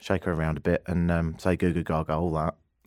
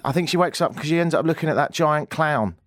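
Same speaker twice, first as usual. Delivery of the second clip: put-on babysitter voice; 8 semitones higher, 4 dB louder than usual. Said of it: "She says".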